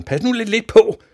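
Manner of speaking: high, very loud scolding; shouted warning. A narrator's reading. Father warns his son to watch out, shouting. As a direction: yell the warning. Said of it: "råbte faren".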